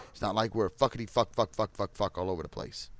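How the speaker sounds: ordinary delivery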